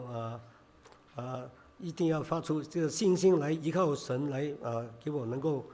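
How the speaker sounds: usual speaking voice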